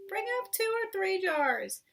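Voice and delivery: the other voice calling back from upstairs. A narrator's reading. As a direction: call it down from the top of the steps. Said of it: "the other voice called back".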